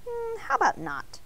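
high little donut voice, unimpressed; a tiny high voice saying no thanks; squeaky donut voice. A narrator's reading. Arnie the donut turning down Mister Bing, high and dry.

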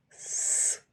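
"с" alone as a long hiss; hard consonant sound. Shown as a demonstration. Teacher gives children the first sound, hard "с". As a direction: sound out a hard "с", held and voiceless. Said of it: "твердий приголосний".

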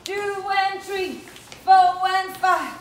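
loud calls of the count over the beat. Real, not acted. Dance coach to the class, counting them through the steps loudly. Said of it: "dance coach counting steps aloud".

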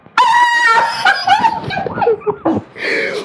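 loud, high shrieking laugh of relief; joyful shriek laugh. Acted. Man hearing his fiancée say yes, shrieking laugh. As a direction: burst into a screaming laugh.

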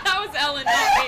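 high, loud laughing words. Real, not acted. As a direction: blurt it out through laughter. Joking after a burp.

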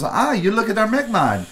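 high-pitched imitation of a Chinese accent; imitated Chinese accent. Booth worker greeting a customer, mimicked Chinese accent. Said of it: "That's how sh-, she said it".

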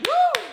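very high, ecstatic whoop at normal level; high praise whoop. Real, not acted. Praise cry mid testimony.

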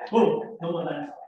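ordinary delivery

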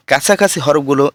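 usual speaking voice

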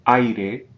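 Aire with ai as one syllable; Spanish word demo. Shown as a demonstration. Teacher models Aire for students, clear Spanish. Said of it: "I'll jump in with my pronunciation".

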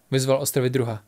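usual speaking voice

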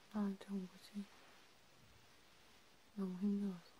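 hushed, half-whispered comfort to a crying friend. Acted. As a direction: soothe them gently, close to the mic.

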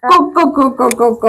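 short, repeated hen clucks; staccato chicken clucking. Shown as a demonstration. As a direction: mimic a clucking hen for a toddler. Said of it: "ഇനി കോഴി എങ്ങനെ കൊക്കുന്നേ?".

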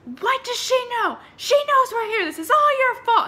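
high, loud shouted quarrel; mock yelling argument. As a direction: shout it like the fighting couple below. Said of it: "they were just, like, yelling".

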